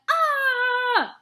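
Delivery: high, loud mock cry imitating Henry; mocking yelp. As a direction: ham up his startled "Ah!". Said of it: "Your last words would have been".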